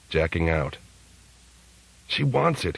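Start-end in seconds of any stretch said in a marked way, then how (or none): 2.1-2.8 mild scream. A narrator's reading. Case screaming about Three Jane, a higher mild yell. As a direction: cry it out, pitched up. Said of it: "he screamed".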